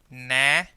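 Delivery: na said loud and held; stressed syllable. Shown as a demonstration. Teacher showing the stressed middle vowel, firmly stressed. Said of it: "the stress sound".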